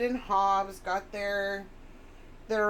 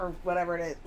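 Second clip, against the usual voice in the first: ordinary delivery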